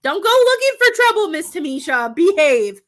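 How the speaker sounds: high, loud scolding warning; scolding elder. Retold or imitated. Voice in her head warning Miss Tamisha off trouble, loud and scolding. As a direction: wag a finger at a wayward girl, loud and high. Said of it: "I always hear".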